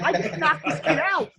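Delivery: high, laughing incredulous aside; laughing disbelief. Retold or imitated. Speaker tells the stranger about the fight, laughing high. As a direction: laugh through the punchline.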